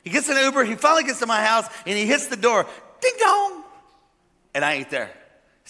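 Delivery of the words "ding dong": high, sung-out doorbell chime; doorbell imitation. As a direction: ring it out like a doorbell.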